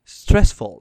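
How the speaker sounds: ordinary talk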